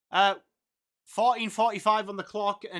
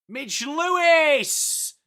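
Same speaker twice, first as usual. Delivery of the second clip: high, loud and drawn-out slow call of the name; excited goal call; 8 semitones higher, 6 dB louder, much slower than usual. Real, not acted. Commentator calls the goal in a rising excited holler. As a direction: stretch the name out like the ball's sailing through.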